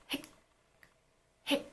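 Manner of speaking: soft hic locked at the back of the throat; tensed hic. Shown as a demonstration. Teacher quietly demonstrating the locked hic. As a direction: stop hic dead with a tense mouth. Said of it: "the insides of your mouth are tensed".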